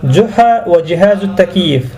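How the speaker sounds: usual speaking voice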